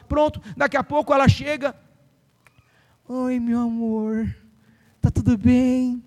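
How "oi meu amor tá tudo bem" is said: slow, syrupy mock-loving greeting; imitating a husband cooing at his wife; sweet greeting. Husband cooing sweetly to his wife, slow and syrupy.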